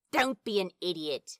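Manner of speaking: ordinary delivery, nothing marked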